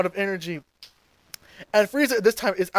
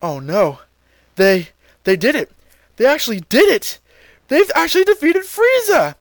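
King Kai elated; 7 semitones higher, 5 dB louder than usual. Retold or imitated. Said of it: "King Kai is watching all of this and says".

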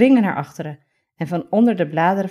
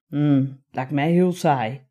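bored heron voice; 3 semitones lower, slower than usual. Acted. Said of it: "zegt Reiger".

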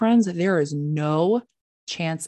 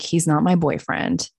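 ordinary delivery

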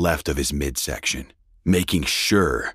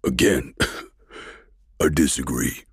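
a wet, choking laugh before the words; choking colonel laughing at her; choked laugh. A narrator's reading. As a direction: laugh through the pain, defiant. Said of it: "He choked out his words".

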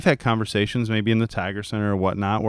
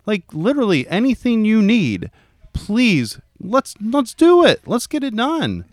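much higher, earnest plea to get on board; exasperated pleading; 12 semitones higher, 5 dB louder than usual. Retold or imitated. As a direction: urge them warmly but incredulously. Coach pleading with parent.

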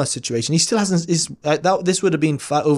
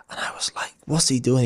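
whispered under the breath, puzzled; a disbelieving whisper to yourself; 4 dB quieter than usual. Retold or imitated. Muttering to himself in disbelief.

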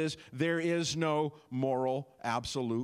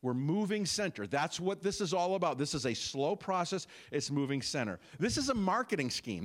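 ordinary talk, nothing marked

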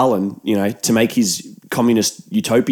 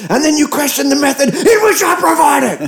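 higher, intense courtroom rant; shaking with anger; 11 semitones higher than usual. Retold or imitated. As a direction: rail at them like a furious colonel. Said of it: "'Cause you can't handle the truth".

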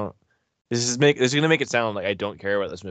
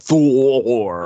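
big, long, loud THOR; drawn-out announcer tease; 4 semitones higher, 10 dB louder than usual. Real, not acted. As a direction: belt it out and hold it. Hyped tease before the break.

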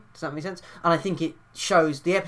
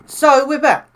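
loud announcer; 11 semitones higher, 12 dB louder, slower than usual. Acted. Announcing return after jingle.

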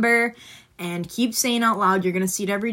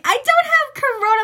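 high teacher voice; 7 dB louder than usual. Retold or imitated.

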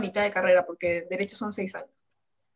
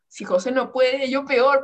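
despairing outburst; 4 semitones higher, 7 dB louder, slower than usual. Retold or imitated.

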